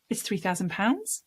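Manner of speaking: "pounds" lifts up at the end like a question; unsure seller quoting a price with upspeak. Shown as a demonstration. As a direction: state the price but let "pounds" rise as if asking. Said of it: "It sounds like a question".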